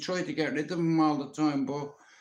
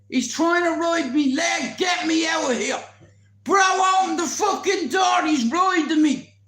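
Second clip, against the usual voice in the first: high, loud panicked yell; retold screaming; 11 semitones higher, 6 dB louder than usual. Trapped man pleading to be let out, screaming. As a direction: yell for someone to open the door. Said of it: "I'm screaming".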